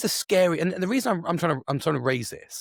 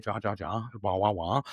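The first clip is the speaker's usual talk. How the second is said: dismissive blah-blah; 7 semitones lower, 5 dB quieter than usual. Acted. Mimics others' chattering objection.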